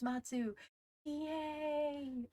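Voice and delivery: normal talking voice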